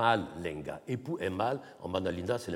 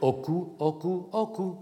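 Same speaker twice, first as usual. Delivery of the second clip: OKU repeated, each one pitched higher; tone demonstration; 7 semitones higher than usual. Shown as a demonstration. Lecturer recalling the tone example, each word higher. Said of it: "comme vous avez avec".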